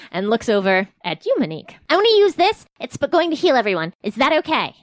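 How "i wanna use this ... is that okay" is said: character voice. Acted. Asteria voiced asking Monique.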